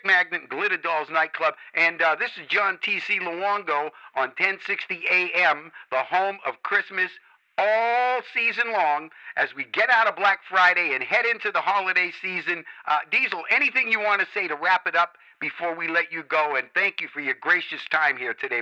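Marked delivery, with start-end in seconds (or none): none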